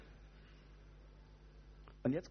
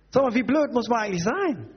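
raised, high-pitched indignation; exasperated rant; 8 semitones higher than usual. Acted. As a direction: berate him in mock disbelief. (empört und fassungslos schimpfen)

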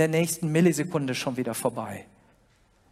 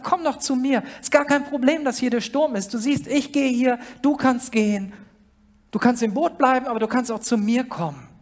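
inviting Jesus; 6 semitones higher than usual. Acted. Jesus voiced inviting listener.

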